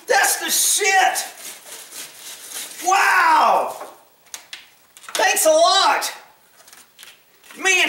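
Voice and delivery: high-pitched, thrilled amazement; real excitement. Real, not acted. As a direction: react overjoyed to an unexpected gift. Thrilled by gifted batteries.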